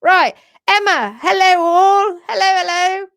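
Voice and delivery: loud, sing-song hellos; cheery greeting. Real, not acted. Host greeting viewers with loud cheer. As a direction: call out a big welcome.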